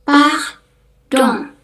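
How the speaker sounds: "Pardon" as pahdoŋ, nasal ending; French pronunciation. Shown as a demonstration. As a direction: model "Pardon" French-style. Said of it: "kita ngomongnya eng". (ucapkan "Pardon" dengan eng)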